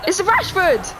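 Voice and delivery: high, loud burst of joy; excited shout. Real, not acted. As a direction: yell it out thrilled. Rare player pulled from pack.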